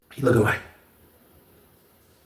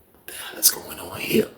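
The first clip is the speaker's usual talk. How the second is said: unvoiced, much softer confusion; whispered; 6 semitones lower, 13 dB quieter than usual. Onlooker wondering what is happening, whispered. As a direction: whisper it in disbelief.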